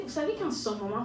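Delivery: normal talking voice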